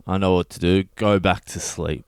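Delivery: ordinary talk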